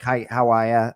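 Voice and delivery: casual Boston-accented greeting; a low-key Boston accent. Shown as a demonstration. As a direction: greet them like a Boston local. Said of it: "how we say it from Boston".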